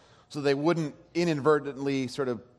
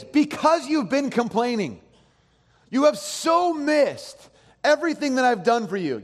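higher, louder, slowed frustrated rebuke; exasperated God; 10 semitones higher, 5 dB louder than usual. Acted. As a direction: scold them like a fed-up father. Preacher voicing God's frustration.

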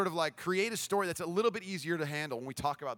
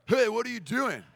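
raised, higher-pitched challenge; retold indignant call; 6 semitones higher, 5 dB louder than usual. Skate guy runs over to a bully, calling out indignantly. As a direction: imitate calling out a troublemaker.

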